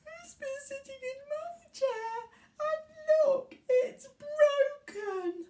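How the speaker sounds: put-on high baby bear, upset at the end. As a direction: squeak it like an upset little cub. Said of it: "And Baby Bear says".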